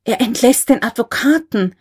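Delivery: understated narrated outcry; crying out repeatedly. A narrator's reading. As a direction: cry out the shocking news. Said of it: "Immer wieder rief er".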